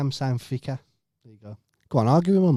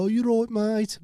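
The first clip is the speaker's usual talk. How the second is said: mocking a Brummie accent, pitched higher; 8 semitones higher than usual. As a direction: parody how outsiders do Brummie. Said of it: "We don't speak like that".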